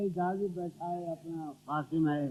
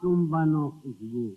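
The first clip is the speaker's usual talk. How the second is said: ghazal recitation; 6 dB louder than usual. Acted. Poet reciting to the gathering, declamatory verse recital. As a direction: declaim verse with weighted pauses.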